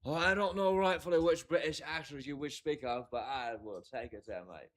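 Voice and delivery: put-on British voice, starting high and falling away; mock British accent. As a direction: play at being British for a joke. Playful reply about British actors.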